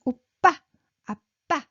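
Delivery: "Oppa, appa" with a short, high last syllable; tense, high "pa" ending both words; high pitched. Shown as a demonstration. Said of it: "high pitched".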